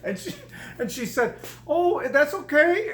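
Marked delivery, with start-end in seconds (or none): 1.7-2.9 wife reassuring. Retold or imitated. Wife's gentle reassurance, voiced high.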